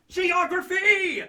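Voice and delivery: high, slow drawn-out yell; triumphant shout. Pretend. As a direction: belt it out as a grand finale. Transformation climax shout.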